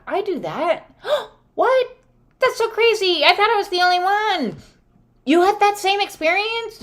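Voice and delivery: high, amazed, gushing; mock excited. New friends discovering they're alike, high excited squeals. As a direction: squeal with astonished delight. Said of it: "They, like".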